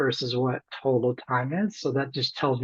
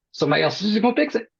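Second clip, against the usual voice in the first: mocking crew voice; 7 semitones higher, 4 dB louder, much faster than usual. Voicing the crews' lazy reasoning.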